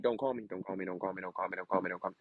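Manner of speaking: soft, hushed repeated plea; a quiet plea whispered to the teacher; pleading under breath. Retold or imitated. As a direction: beg quietly so no one hears. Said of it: "You'd be like".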